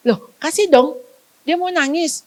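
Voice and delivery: high, sing-song permission to cry; a teasing parent letting the child cry; mock indulgent parent. Shown as a demonstration. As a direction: call the child's bluff by inviting the tears. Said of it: "Suruh dia nang".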